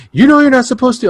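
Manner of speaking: high, loud nagging voice; mock the prissy staffer; imitating a fussy clerk; scolding employee. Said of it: "the guy was like".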